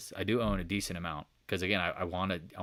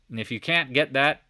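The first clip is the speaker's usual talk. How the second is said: ordinary talk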